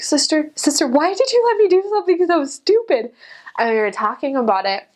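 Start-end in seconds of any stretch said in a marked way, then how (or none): 0.0-3.1 much higher, wailing to her companion; mock despair. Missionary wailing to her companion in mock despair. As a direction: whine in comic regret. After dumping the alcohol.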